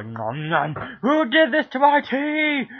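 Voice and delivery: very high, loud, slow mock outrage; angry sister. Teen imitates the sister finding her tea ruined, in a loud shriek. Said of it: "She's gonna be all".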